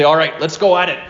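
mock fighting challenge. Voicing a challenge to demons.

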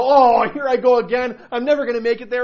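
high, loud self-pitying wail; hammed-up despair at failing yet again; mock misery. Sinner beating himself up after a fall, mock misery. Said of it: "feel miserable about myself".

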